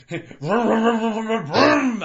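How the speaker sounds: loud, high vroom vroom of a sputtering car; cartoonish car engine noise, slowing at the end; imitated engine. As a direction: mimic a stubborn engine trying to start. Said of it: "that's what mine is".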